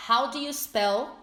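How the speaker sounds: slow, clear teacher model; correct question. Shown as a demonstration. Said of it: "você tem que perguntar".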